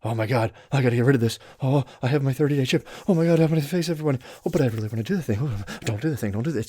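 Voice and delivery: flit anxiously between craving and guilt; a hammed-up frantic inner monologue; mock panicked inner voice. Voicing a recovering addict's panicked thoughts.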